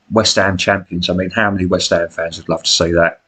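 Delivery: usual speaking voice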